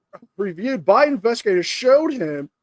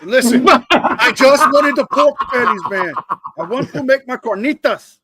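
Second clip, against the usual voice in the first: lightly put-on Mexican accent, louder at the start; Eddie Guerrero impression; 2 semitones higher, 4 dB louder than usual. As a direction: play the offended pork thief with a Mexican accent. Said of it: "Why do you sound like Eddie Guerrero?".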